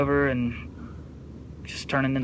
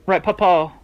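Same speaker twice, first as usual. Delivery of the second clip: calling out; 6 semitones higher, 9 dB louder than usual. Real, not acted. Calling out to Papa.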